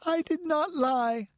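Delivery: put-on very high, desperate child's denial; pleading, high-pitched wail; sobbing protest. A narrator's reading. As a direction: insist tearfully in a high voice. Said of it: "sobbed Tina".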